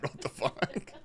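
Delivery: breathy laugh through words. Real, not acted. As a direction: laugh it out in disbelief. Reacting to a pinned chat message.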